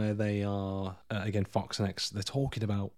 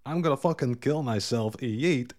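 louder, higher mock-dramatic quote; mock movie line; 5 semitones higher, 5 dB louder than usual. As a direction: exaggerate the jumper's cry. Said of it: "jumping off that building saying".

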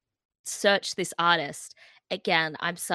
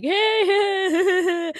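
high, loud fan squeal; gleeful high-pitched heys; imitated excited greeting; 13 semitones higher, 6 dB louder, much slower than usual. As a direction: act out squealing hellos at a favourite band. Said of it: "meet Voyagers and we're like".

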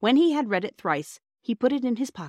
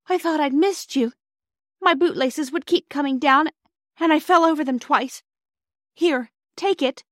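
put-on high, louder, breathless girl; out of breath; 8 semitones higher, 5 dB louder than usual. A narrator's reading. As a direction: blurt it out after running for the train. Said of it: "quite out of breath with running".

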